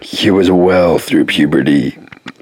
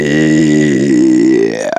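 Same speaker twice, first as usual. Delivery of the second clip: Anyway stretched very slow and lower; slow drawn-out anyway; 5 semitones lower, much slower than usual. Shown as a demonstration. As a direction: draw out Anyway to waste time, low. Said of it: "sorry, I was just wasting time".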